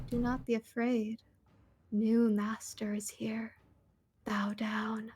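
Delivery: soft, slow, whispered command from the pit; eerie monster whisper. Acted. Giant bug speaking to the hive, eerie whisper. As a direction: hush it like a creeping hive queen. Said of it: "she's gonna say in the telepathy".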